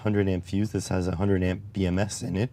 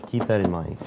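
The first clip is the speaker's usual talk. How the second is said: ordinary talk, nothing marked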